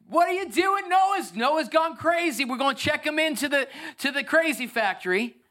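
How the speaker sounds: mock him like a scornful neighbour shouting. Neighbours jeering at Noah building the ark, high mocking shout.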